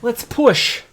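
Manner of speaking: put-on high, loud call, drawn out on skinny elf boy; laborer shouting. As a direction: yell at the elf to come help. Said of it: "he's like".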